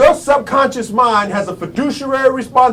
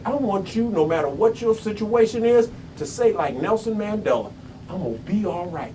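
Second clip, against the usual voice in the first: hushed appeal; 3 semitones lower, 9 dB quieter than usual. Real, not acted. Motivational speaker urging the audience to repeat Mandela's words, hushed. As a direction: drop to a soft, low intensity.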